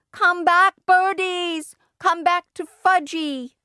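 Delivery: put-on high, drawn-out toddler scream. A narrator's reading. Fudge screaming after the pigeons, high-pitched screaming. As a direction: wail it high after the escaping birds. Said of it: "was screaming".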